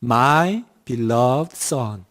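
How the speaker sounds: "My beloved son" read very slowly, each word drawn out, falling; slow, careful reading of "My beloved son"; slow English reading. Shown as a demonstration. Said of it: "예문이 이렇게 나왔어요".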